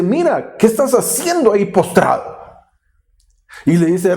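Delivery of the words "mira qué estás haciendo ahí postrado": high-pitched reproach, imitating a rebuke; retold divine telling-off; scolding. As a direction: scold him as God would, voice raised in pitch. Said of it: "Dios lo regaña".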